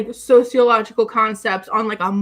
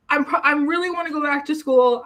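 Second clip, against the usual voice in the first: ordinary delivery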